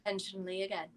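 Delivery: quiet promise; soft murmur. Acted. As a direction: murmur it close and gentle. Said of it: "it's just like".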